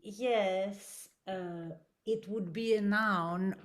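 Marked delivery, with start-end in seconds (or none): none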